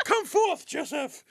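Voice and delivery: theatrical herald voice. Retold or imitated. Show's announcer.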